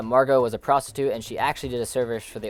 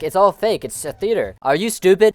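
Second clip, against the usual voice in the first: higher, louder dismissive scoffing; mock indignant outburst; 6 semitones higher, 6 dB louder than usual. Food critic dismissing it all as theater, mock indignant. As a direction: play up the critic's exasperation.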